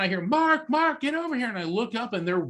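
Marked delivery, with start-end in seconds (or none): 0.2-1.5 calling out. Retold or imitated. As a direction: shout his name to get him over. Manager calling him across hall.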